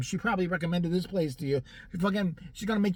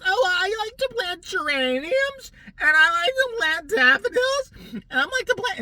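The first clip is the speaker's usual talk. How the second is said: very high, louder, slow put-on voice; mock gardening lady; 13 semitones higher, 6 dB louder, slower than usual. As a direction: drag out the plant list, pitched way up. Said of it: "he's in there's like".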